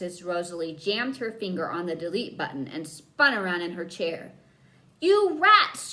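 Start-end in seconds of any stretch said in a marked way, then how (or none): 5.0-5.9 high loud angry holler. A narrator's reading. Girl with missing teeth yells at the two boys. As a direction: yell it at the boys. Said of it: "she hollered".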